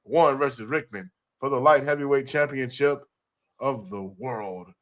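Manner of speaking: hype announcer. Acted. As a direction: call it like a ring announcer. Announces the next event.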